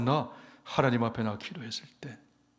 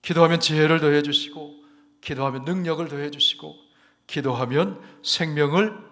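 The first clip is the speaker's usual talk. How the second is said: 1 preaching at full force; 4 semitones higher, 8 dB louder than usual. Acted. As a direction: build the sermon to its peak. Preaching climax on prayer. (점점 고조되는 힘찬 설교)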